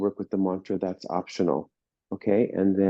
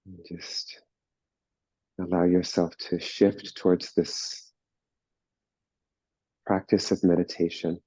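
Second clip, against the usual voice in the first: slow, calm meditation voice with long pauses; guided meditation; 2 semitones lower than usual. Real, not acted. Teacher settling a class into meditation, slow and calm. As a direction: ease them into stillness, unhurried.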